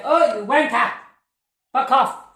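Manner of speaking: furious shout. Real, not acted. Yelling at the ghost.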